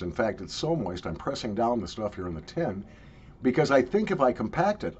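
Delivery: ordinary talk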